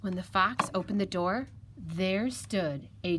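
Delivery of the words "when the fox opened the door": ordinary delivery, nothing marked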